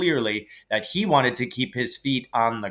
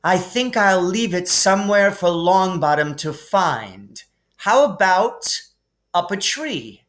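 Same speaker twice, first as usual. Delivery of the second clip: higher, smug nasty tease; nasty taunt; 6 semitones higher, 3 dB louder than usual. A narrator's reading. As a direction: dangle it over him smugly. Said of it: "smiled nastily".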